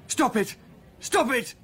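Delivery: high, loud pleading cry; panicked yell. Acted. Rat gnaws through bell rope.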